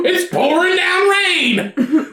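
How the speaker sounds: high, drawn-out mock quote; mocking imitation. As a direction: parrot it slowly in a high voice. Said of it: "And you're like".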